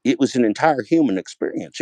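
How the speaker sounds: normal talking voice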